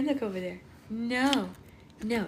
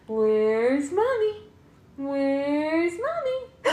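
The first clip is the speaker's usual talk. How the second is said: peekaboo singsong; 7 semitones higher, 4 dB louder, much slower than usual. Pretend. Peekaboo game with baby.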